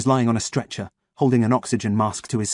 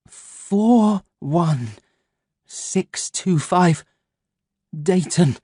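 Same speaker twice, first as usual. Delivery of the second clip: dazed recital; 7 semitones higher, much slower than usual. Acted. Dazed man reciting number again.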